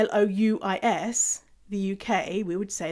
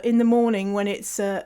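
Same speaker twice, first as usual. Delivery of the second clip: normal talking voice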